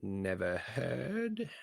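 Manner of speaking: slow, high, drawn-out skeptical echo; sly doubt. Acted. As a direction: stretch it out, doubting him. Sineas doubts Berwick's words.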